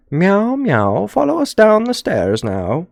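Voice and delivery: high-pitched British cat, meowing; horrible British accent. Acted. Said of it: "That was a horrible British accent".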